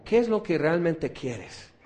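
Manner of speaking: ordinary talk, nothing marked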